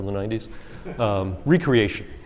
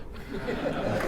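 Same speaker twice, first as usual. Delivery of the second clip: soft breathy chuckle at his own joke; short laugh; 9 dB quieter than usual. Real, not acted. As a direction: let a quick quiet laugh slip out. Joke about being a ten-year-old.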